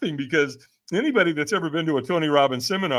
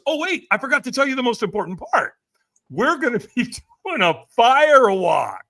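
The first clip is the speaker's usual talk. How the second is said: high-pitched imitation of a gushing buddy; excited afterthought; 8 semitones higher, 4 dB louder than usual. Buddy's last-minute big reveal, retold.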